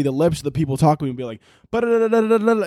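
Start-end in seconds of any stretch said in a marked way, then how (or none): 1.7-2.7 rapid higher-pitched lip-flap gibberish; super-fast babble. Retold or imitated. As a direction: rattle off nonsense syllables at speed. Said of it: "like, super fast".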